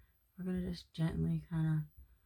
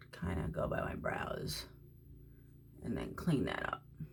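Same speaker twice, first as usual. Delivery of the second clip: whispering while doing the brows; 11 semitones lower, 9 dB quieter than usual. Real, not acted. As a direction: drop into a breathy hush as if concentrating. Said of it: "don't know why I'm talking like that".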